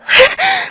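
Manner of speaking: high rising mocking laugh; a sharp sneering laugh that shoots up; mother-in-law scoffing at her daughter-in-law, scornful laugh. Mother-in-law's scornful outburst.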